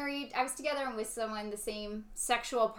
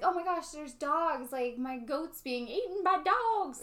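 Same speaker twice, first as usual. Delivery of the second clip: higher and slow, mock panic; alarmed child; 6 semitones higher than usual. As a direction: gasp it out like a frightened farm girl. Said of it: "And I was like".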